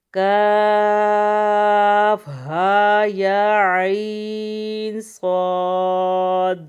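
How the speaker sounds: slow, loud drawn-out tajweed recitation; Quranic recitation. Shown as a demonstration. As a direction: chant each letter with long drawn vowels. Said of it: "کاف کے اوپر جو ماد ہے، اسے بھی ہم چھ الف کے برابر کھینچیں گے".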